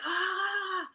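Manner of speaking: high, startled mock gasp; a sharp little cry of disbelief, imitated; imitating a gasp. Retelling Kelly Holmes at the finish line, high mock gasp. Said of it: "and she's like".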